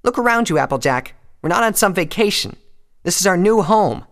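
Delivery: loud, high-pitched rebuke; Rainbow's angry lashing. A narrator's reading. Rainbow telling Applejack they are stuck, angry and loud. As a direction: drive each point home, high and angry. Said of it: "stunned by the angry lashing from her friend".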